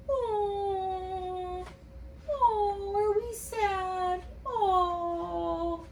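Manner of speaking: high mock whimpering oh; sad monster. Teacher pretending to be the sad blue monster, mock crying. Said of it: "Pretend to be a sad blue monster".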